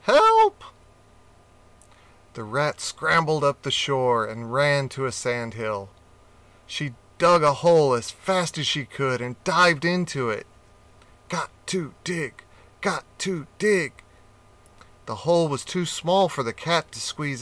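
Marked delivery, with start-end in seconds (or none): 0.0-0.6 shrill loud yell of help; cry for help. Acted. Rat fleeing cat. 11.3-14.0 quick, breathy digging mutter; frantic rat. Acted.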